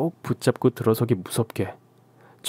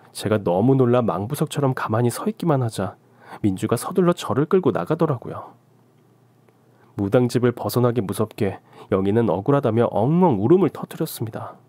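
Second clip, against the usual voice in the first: plain reading voice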